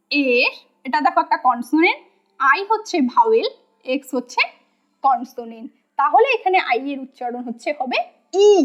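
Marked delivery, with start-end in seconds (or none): none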